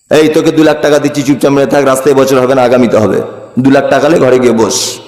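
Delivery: low, quick under-the-table offer; buy him off in a low, hurried voice; hushed bribe. Acted. Party leader paying off a protester, low and conspiratorial.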